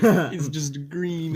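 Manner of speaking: high, loud, stretched-out stoned wonder; awestruck stoned friend. Retold or imitated. Imitating friend high on party chat explaining a color, loud stretched awe. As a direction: draw it out as a mock tripping friend.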